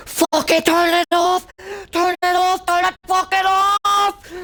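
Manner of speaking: very high, loud, slow screamed plea; retold agonised yell, very high and loud; screaming in pain. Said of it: "I fucking screamed the fucking place down".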